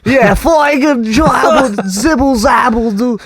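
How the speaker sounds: very high, loud cartoonish Cosby voice; sing-song Cosby nonsense; Bill Cosby impression. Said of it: "my Bill Cosby impression".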